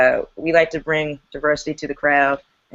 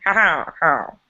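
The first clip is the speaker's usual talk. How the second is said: Jeff Goldblum laugh. Retold or imitated. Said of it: "Laugh from Jurassic Park".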